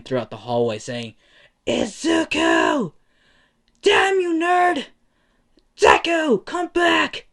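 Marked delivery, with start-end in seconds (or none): 1.7-7.3 very high, loud drawn-out screams; screaming. Acted. Abandoned bully screaming down the hallway after his rival, furious. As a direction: scream each call at full force, far up in pitch. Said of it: "hear the screams of Bakugo just echoing".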